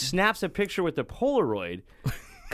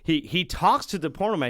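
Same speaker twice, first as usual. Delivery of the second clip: ordinary delivery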